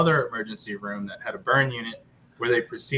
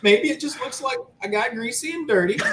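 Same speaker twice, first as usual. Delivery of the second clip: much higher, wishful voice; hopeful self-reassurance; 12 semitones higher, 3 dB louder than usual. Retold or imitated. Burned dad at the mirror, falsely hopeful. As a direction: talk yourself into believing it's fine. Talking himself into calm at mirror.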